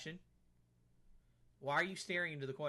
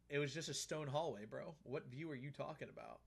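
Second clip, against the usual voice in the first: ordinary delivery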